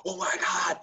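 high frantic retold fear; father fearing the baby cannot breathe, high and frantic; panicked. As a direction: voice shooting up in alarm.

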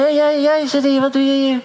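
put-on high, sharp rebuke at a boy; scolding. Leader rebuking a boy in the meeting, high scolding. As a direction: call the boy out in front of everyone. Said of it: "hij bestrafte hem aan het publiek".

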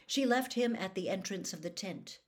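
ordinary delivery

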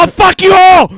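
very high, loud retold yell; shouted challenge. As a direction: yell it out as a challenge to the whole crowd. Acted threat to whole crowd.

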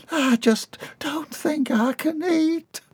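high whispered, slow; tearful whisper. A narrator's reading. Bobby Sue weeping at dinner, slow high whisper. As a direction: whisper it through tears.

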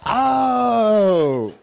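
loud, high, long-held shout; crowd cheer. Retold or imitated. Puzzled Georgia fans play along, long cheering yell. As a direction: yell the letter back, stretching it out. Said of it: "you can tell everybody's like".

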